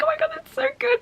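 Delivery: high-pitched imitated gushing; gushing reaction. Reader reliving looking at the pages, gushing.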